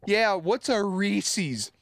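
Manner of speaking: high, slow put-on scoff; mock him for not knowing; self, defending coworker, high and drawn out. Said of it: "I legitimately was just like".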